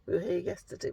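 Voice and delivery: ordinary delivery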